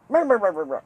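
loud, high mock ranting noise; mocking rah-rah. Speaker mimicking an argument, mocking rant. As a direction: blare it out as mock nagging.

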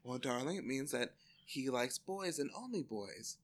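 hushed, delicate posh mummy explaining; mock refined mother, low and quiet; mother's voice. Voicing mother in retold story.

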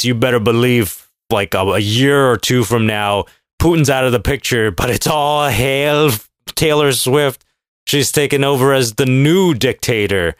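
usual speaking voice

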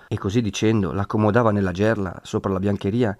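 ordinary delivery

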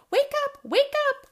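very high, loud alarm cry; screeching. Acted. Mother owl wakes owlets in a storm, screeching. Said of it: "screeched Mother Owl".

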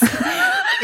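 short high-pitched giggle; high squeal of laughter. Real, not acted. Laughing at search term.

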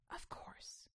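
breathy secret whisper. A narrator's reading. Jedi girl answers mysteriously.

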